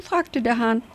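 plain reading voice

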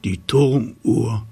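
put-on slow, measured chant to children; nightwatchman rhyme. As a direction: call it like a tower watchman. Turmwächter-Reim zum Sendungsbeginn. (langsam gereimter Wächterruf)

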